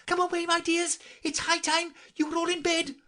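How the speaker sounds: trembling canary. A narrator's reading. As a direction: hurry children away, trembling. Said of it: "called out in a trembling voice".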